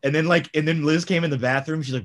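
usual speaking voice

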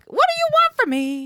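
high squeaky mock whine of exasperation; exasperated squeak. Friend mocking her own reaction to a flaky guy, high exasperated squeal. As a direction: squeal it out, fed up.

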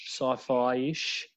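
ordinary delivery

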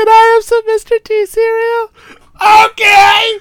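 high put-on man-child chirp; hammed-up Pee-wee voice. Quoting Pee-wee's breakfast scene.

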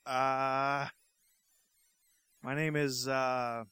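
half-hearted alien voice with long hesitations; alien captain. Pretend. Alien captain greets an Earthling, halting half-hearted alien voice. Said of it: "In your best alien voice".

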